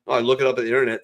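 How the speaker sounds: ordinary talk, nothing marked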